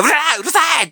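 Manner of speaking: high, loud coach bellowing; angry yell. Acted. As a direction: bark it down like a furious coach. Coach yelling at player.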